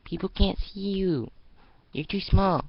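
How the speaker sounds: put-on high, pleading little girl warning. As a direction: try to keep her close. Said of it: "I said that badly".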